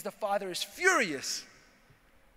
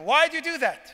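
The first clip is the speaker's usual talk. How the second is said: high, loud startled question; as the wife who can't believe he sold them; shocked wife; 8 semitones higher, 9 dB louder than usual. Retold or imitated. Wife to husband, shocked loud high question.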